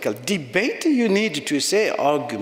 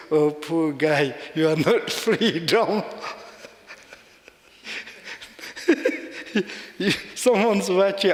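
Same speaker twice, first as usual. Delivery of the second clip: mock pity, laughing. Quoting the priest's teasing, laughing. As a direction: pity him through a laugh. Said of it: "he like this and said".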